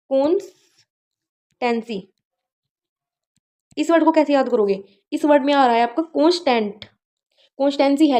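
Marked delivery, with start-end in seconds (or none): 0.1-2.0 slow repeat. Shown as a demonstration. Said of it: "अब अगला word आता है कॉन्स्टेंसी".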